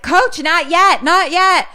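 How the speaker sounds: high, loud, stretched command; acted-out loud warning; urgent calling out. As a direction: call out to stop him, urgently. Retelling Tangi's urgent command.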